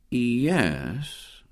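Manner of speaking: a soft, drawn-out yes; slowly. A narrator's reading. William, hatching a plan, says it slowly and softly. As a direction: let the word come slow and thoughtful. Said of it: "said William slowly".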